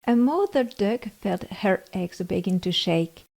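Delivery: plain reading voice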